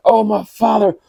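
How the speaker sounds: high, loud desperate plea; begging. Acted. Esau begs his father for a blessing, high loud cry. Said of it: "he begged".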